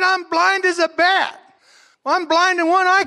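high, loud, desperate pleading voice; pleading blind man. Acted. Blind Bartimaeus answering Jesus, high loud desperate plea. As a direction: plead urgently to be healed.

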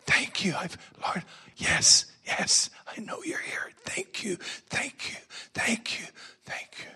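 soft whispered prayer of thanks. Shown as a demonstration. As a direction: breathe your thanks to God. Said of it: "alone with God in your closet".

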